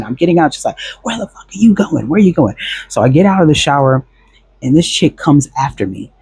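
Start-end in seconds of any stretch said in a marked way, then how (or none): 1.0-2.5 higher-pitched mock confrontation; retold angry demand. Partner demanding answers as someone leaves the shower, retold angry demand. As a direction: imitate an insistent demand, pitched up.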